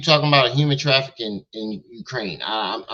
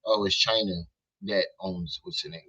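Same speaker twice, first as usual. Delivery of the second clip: ordinary talk, nothing marked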